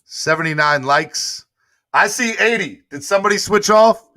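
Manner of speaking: rally the viewers to keep the likes up; high-pitched playful alarm at the count; excited. Real, not acted. Watching the like count drop.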